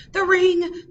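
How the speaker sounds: high, loud cry of dismay; alarmed. A narrator's reading. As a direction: exclaim it in alarm. Man has lost wedding ring.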